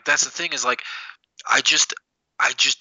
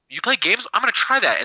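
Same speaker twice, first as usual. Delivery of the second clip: mock excited girl; 8 semitones higher than usual. Girls quoted, voice raised.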